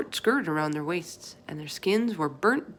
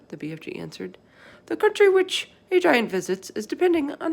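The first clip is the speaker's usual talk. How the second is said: BFG voice; 3 dB louder than usual. Acted. Giant explaining to Sophie what the others do, folksy. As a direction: play the big friendly giant, chatty and earnest.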